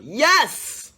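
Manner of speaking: loud, high yelled yes. A narrator's reading. King calling for a dish he wants, yelling. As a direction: shout it out like a royal command. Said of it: "so he yelled".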